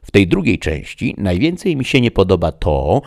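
loud, high, animated opinion; gentleman reader, arguing. Acted. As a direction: make the case emphatically. Don Juan argues for reading.

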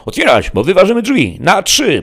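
loud, high-pitched ultimatum; police shouting before a break-in; shouted police warning. A narrator's reading. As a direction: bellow a warning through the door.

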